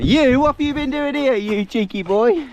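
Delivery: mock scolding in pet-talk; playful scolding. Real, not acted. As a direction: tease the naughty dog up high.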